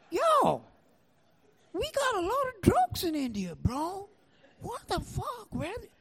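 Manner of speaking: soft, very high plaintive voice; incredulous protest. Retold or imitated. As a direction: complain in a small squeaky voice.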